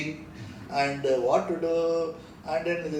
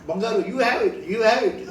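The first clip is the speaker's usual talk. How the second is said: laughing quote; 6 semitones higher, 5 dB louder than usual. Retold or imitated. Swami offers the meal.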